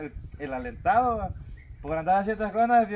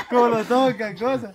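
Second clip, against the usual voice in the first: normal talking voice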